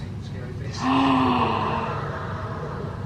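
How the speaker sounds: delighted gasp. Real, not acted. Sees finished bracelet.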